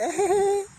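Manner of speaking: very high, drawn-out giggle; mock her giggle way up high; imitated giggle. Vlogger mimicking the wrestler's happy giggle, very high. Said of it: "giggling way too much".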